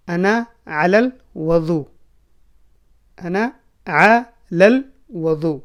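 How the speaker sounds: very slow, every syllable drawn out; slow demo. Shown as a demonstration. Teacher slowly modelling Arabic for Urdu learners. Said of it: "میرا وضو ہے".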